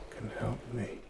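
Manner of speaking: ordinary talk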